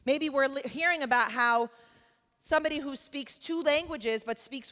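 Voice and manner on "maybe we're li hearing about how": normal talking voice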